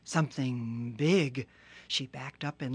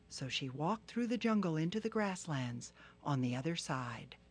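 ordinary delivery

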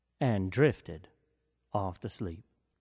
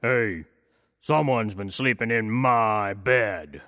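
slow, lightly voiced papa bear; a big bear, puzzled, taking his time; gentle hint of a father bear; slower than usual. A narrator's reading. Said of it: "the papa bear ... and said".

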